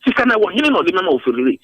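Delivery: ordinary talk, nothing marked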